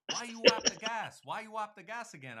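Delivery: high, loud mock interrogation; exasperated telling-off, acted out; accusing scolding. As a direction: imitate someone chewing out a driver. Retold scolding by the other man.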